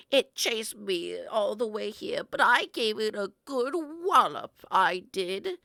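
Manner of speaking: slow, loud boasting grandma; feisty grandma. Pretend. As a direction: brag about the wallop.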